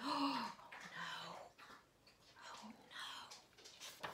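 gasp, then a fading hushed oh no; fearful gasp. A narrator's reading. Narrator fearing for the hunter, gasping then whispering. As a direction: sound scared, then whisper it.

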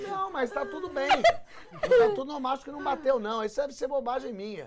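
put-on high voice insisting all is fine; stoned wife. Stoned wife telling husband it hasn't kicked in, high-pitched denial.

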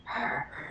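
imitated raspy breath, soft and wordless. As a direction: breathe out raspily like a lurking thing. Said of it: "I hear this raspy, like".

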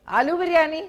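high, loud surprised echo of आलू biryani; surprised question. Real, not acted. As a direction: react as if caught off guard. Caller mentions a past dish.

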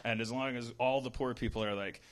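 ordinary talk, nothing marked

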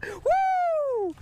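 whooping laugh. Real, not acted. Fish netted.

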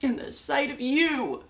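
loud, high, anguished cries; hysterical breakdown. Acted. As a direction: build into a wailing panic. Breakdown over bringing it up.

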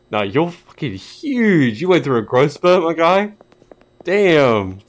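high, loud, slow amazed jeer; mock amazement. Gamer teasing the suddenly tall NPC, loud mock amazement. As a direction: marvel at his height teasingly.

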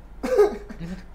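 eerie ghost voice. Pretend. Mimicking the typing ghost.

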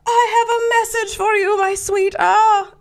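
very high mock seance voice; hammed-up medium. Medium delivering mother's message to Houdini, high theatrical put-on voice. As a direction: croon it like a phony spirit channeler. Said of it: "She's like".

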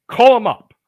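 loud, high urging; imitating pushy friend. Friend urging him to phone a rocker, retold as an eager push.

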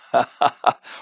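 high, forced mocking laugh; too-hearty laugh. A narrator's reading. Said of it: "Jim laughed, a bit too heartily".

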